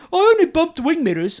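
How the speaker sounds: high, loud mocking Birmingham accent; Brummie accent impression. The driver brushing off the bumped mirror in a Brummie accent. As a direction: ham up a smug Brummie telling him it's no problem. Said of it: "He goes".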